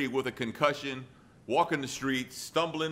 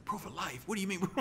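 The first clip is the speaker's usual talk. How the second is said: higher, quiet panicked questioning; lightly acted alarm; mock freak-out; 7 semitones higher, 4 dB quieter than usual. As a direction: imitate someone freaking out, voice pitched up. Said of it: "that freaks her out all over again".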